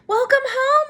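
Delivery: high, loud welcome; cheery greeting. Acted. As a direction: greet her brightly. Parents greet daughter warmly.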